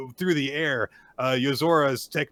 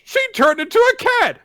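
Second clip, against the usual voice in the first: much higher, louder sarcastic praise; mocking sarcasm; 10 semitones higher, 6 dB louder than usual. Mocking narrator voice for Gakuro.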